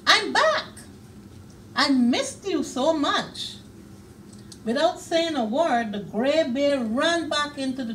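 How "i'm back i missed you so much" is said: high and loud, bursting with joy; happy reunion call; excited cry. A narrator's reading. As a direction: bear telling his friend he missed him, high and eager. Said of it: "cried the bear".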